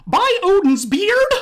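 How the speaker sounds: very high, loud squeaky cartoon mouse; squeaky mouse asking in falsetto; Mickey Mouse voice. Shown as a demonstration. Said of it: "how to do this voice".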